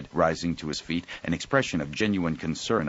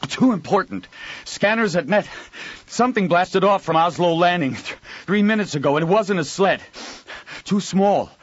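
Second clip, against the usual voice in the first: high, loud panting alarm; breathless urgent cry; 12 semitones higher, 6 dB louder than usual. Acted. Breathless young man reports.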